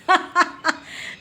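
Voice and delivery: high, loud burst of laughter. Real, not acted. Mother laughs at her son's dance, high loud laugh.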